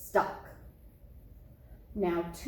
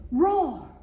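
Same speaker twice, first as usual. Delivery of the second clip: sudden loud roar, higher and louder; 8 semitones higher, 8 dB louder than usual. A narrator's reading. Narrator booming the monster's loud roar. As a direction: boom out the roar of the night. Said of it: "a loud".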